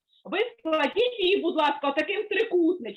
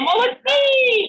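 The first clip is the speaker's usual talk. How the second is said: excited praise; 8 semitones higher, 7 dB louder, slower than usual. Real, not acted. Praising kids after song. (радісно й голосно хвалить дітей)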